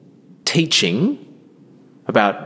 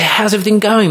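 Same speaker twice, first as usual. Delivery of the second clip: bright eager greeting; 9 semitones higher, faster than usual. Retold or imitated. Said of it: "You say".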